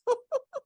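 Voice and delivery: high little laugh; a quick delighted giggle; playful squeak of a laugh. Real, not acted. Laugh after demo.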